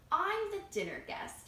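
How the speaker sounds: bright, happy outburst; joyful exclamation. Acted. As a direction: exclaim it with joy. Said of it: "he exclaimed with joy".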